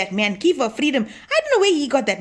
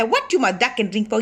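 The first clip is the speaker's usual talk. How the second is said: ordinary talk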